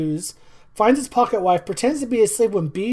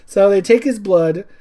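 ordinary talk, nothing marked

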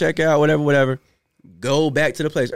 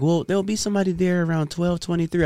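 imitating the dispatcher; 4 semitones higher, 4 dB quieter than usual. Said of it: "She was like".

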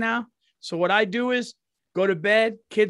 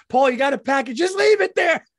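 mock nosy neighbor; 5 semitones higher, 5 dB louder than usual. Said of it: "Your neighbor's like".

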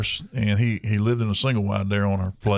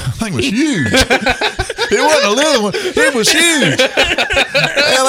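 laughing; 6 dB louder, slower than usual. Real, not acted. Laughing while describing huge plant.

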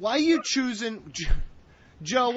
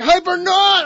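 mock superhero shout; 9 semitones higher, 11 dB louder than usual. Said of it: "She's like".

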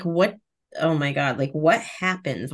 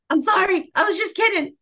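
panicked apology; 12 semitones higher than usual. Retold or imitated. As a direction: blurt it out mortified, fast. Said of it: "I was mortified".

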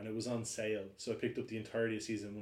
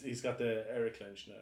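ordinary delivery

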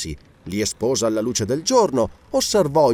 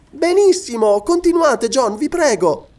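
very high, louder, delighted urging; high-voiced, enthusiastic encouragement; eager lady; 14 semitones higher, 5 dB louder than usual. A narrator's reading. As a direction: beg him to go on, pitched high. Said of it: "disse Lady Elena".

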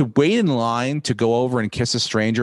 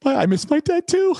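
much higher, half-choked mock sob; hammed-up weepy voice, higher; mock teary. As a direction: play up a fake tearful squeak. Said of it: "I'm like".

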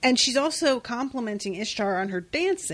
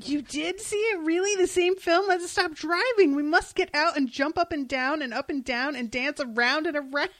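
put-on higher, bouncy childlike excitement; excited; 6 semitones higher than usual. Fan voicing Delirium excited about the film, higher and giddy.

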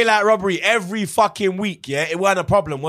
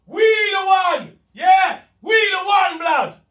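triumphant yelling; 12 semitones higher, slower than usual. Real, not acted. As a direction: chant it like you've just won. Triumphant fan chanting.

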